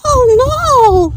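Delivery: high, loud cry of despair. A narrator's reading. Pig crying out in despair at being too late. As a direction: wail it out as if all is lost. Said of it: "Pig cried in despair".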